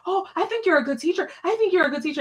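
smooth it over, too late; backpedaling boss. Retold or imitated. Principal backpedaling after going too far.

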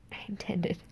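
trailing off into a soft whisper. Real, not acted. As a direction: drop to a whisper.

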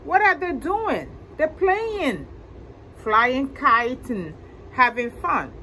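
ordinary delivery

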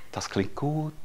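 whispered inner thought. Pretend. Voicing the listeners' inner chatter. (geflüstert wie ein Gedanke)

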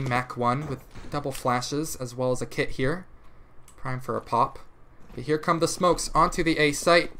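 usual speaking voice

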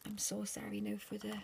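ordinary talk

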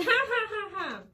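high loud ha-ha-ha laugh; big shared laugh; hearty laugh. Acted. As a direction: laugh along like both characters. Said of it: "Los dos se ríen".

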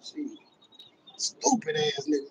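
usual speaking voice

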